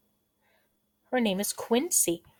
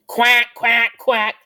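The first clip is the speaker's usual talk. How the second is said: loud, raised duck quacks; duck quacking; 3 semitones higher, 8 dB louder than usual. Acted. Teacher answering what duckies say, loud duck quacks. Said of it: "Duckies usually say".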